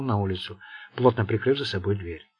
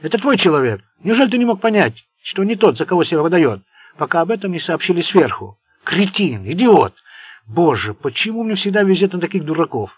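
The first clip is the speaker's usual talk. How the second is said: loud, higher furious scolding; angry tirade; 5 semitones higher, 7 dB louder than usual. A narrator's reading. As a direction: berate him, boiling over. Seymour raging at Tarin.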